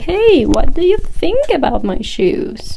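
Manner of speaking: high, drawn-out, sing-song "hey" and question; mock fishing for approval; validation-seeking question. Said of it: "you say something like".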